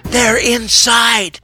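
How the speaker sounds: high, slow, frightened warning; panicked stage whisper. A narrator's reading. Amy warns Lena that killers entered, panicked and high. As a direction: draw out the warning in high alarm. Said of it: "Amy whispered".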